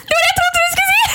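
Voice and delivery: very high, laughing outburst; shrieking laughter. Real, not acted. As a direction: squeal it out through helpless laughter. Bursts out at the shock punchline. (hylende av latter)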